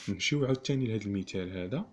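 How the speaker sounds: normal talking voice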